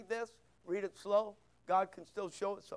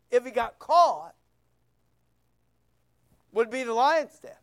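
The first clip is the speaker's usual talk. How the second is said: louder, driving sermon climax; full-force preaching; 3 semitones higher, 8 dB louder than usual. Acted. Pastor rousing the congregation about Daniel's courage, full-voiced preaching. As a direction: preach it out with fire.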